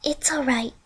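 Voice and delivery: breathy whispered reassurance; hushed self-comfort; whisper to herself. A narrator's reading. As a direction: whisper it to calm yourself. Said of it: "she said to herself".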